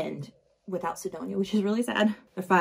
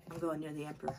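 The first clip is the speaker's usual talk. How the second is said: low, much softer reply; quiet defiance; 4 semitones lower, 16 dB quieter, much faster than usual. Acted. Nemesis answers the guard blocking her way, quietly defiant. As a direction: answer back low and under the breath. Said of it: "And Nemesis is like".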